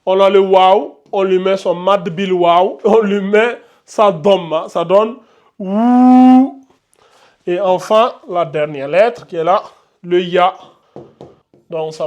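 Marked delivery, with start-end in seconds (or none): 5.6-6.6 "wou" held long, higher and louder; voyelle allongée. Shown as a demonstration. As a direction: stretch the "ou" of wou on one steady note. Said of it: "on l'allonge en deux secondes". (« wou » avec un « ou » longuement tenu)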